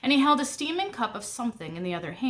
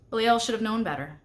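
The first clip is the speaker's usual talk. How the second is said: plain reading voice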